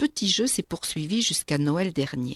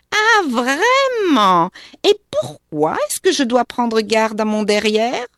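a sour little witch, mocking; shrill spiteful witch; 5 semitones higher, 8 dB louder than usual. A narrator's reading. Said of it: "une petite voix pointue, aigre, méchante". (voix de sorcière pointue, aigre et méchante)

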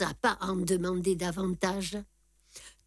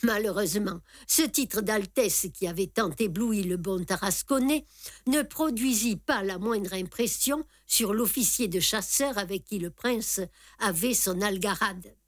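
ordinary delivery, nothing marked

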